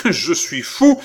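high, loud, broken-up outburst; jerky outraged cry. Acted. Philippe throws the insult back, crying out jerkily. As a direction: cry it out in jolts. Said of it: "s'écria Philippe d'une voix saccadée".

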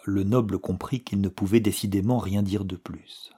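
ordinary delivery

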